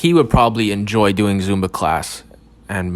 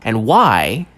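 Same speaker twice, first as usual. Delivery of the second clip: mystery documentary narrator; 2 semitones higher, 4 dB louder than usual. Acted. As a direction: tease the audience like a late-night mystery show host.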